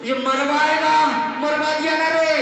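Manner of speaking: high, loud, drawn-out lament; exasperated shouted wail. Acted. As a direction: cry out in comic despair, stretching the words. Beaten thief wails at blunderer.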